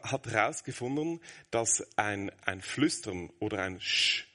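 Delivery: usual speaking voice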